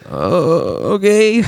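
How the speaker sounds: Morty voice. Retold or imitated. Morty giving in.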